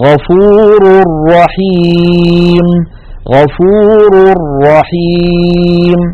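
غفور رحيم chanted very slowly as "ghafoor-rraheem" with a doubled ra and long ي; slow drawn-out Quranic recitation; tanween merged into ra. Shown as a demonstration. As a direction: stretch رحيم long, no nasal hum. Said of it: "مثال التنوين عند الراء".

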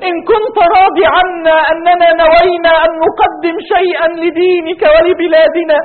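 rising, impassioned supplication, voice breaking into tears; fervent prayer. Real, not acted. Preacher leading the congregation in tearful prayer. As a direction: pour out a tearful plea to God.

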